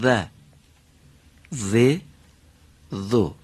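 "ذو" with tongue between teeth, voiced; teacher modeling; Arabic dhal demo. Shown as a demonstration.

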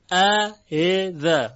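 اَإِذَا slow, second hamza melting into a short i; softened second hamza. Shown as a demonstration. Teacher demonstrates tashil, slow syllables. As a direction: draw out اَإِذَا, easing a into i.

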